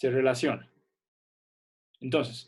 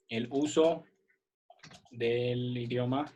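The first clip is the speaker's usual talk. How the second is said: slow dictation; much slower than usual. Shown as a demonstration. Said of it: "Entonces aquí vamos a poner".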